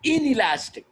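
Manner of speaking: inelastic called out high and loud; emphatic called-out answer. Shown as a demonstration. Teacher calling the answer out to the class, emphatic.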